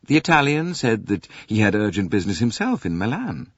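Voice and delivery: plain reading voice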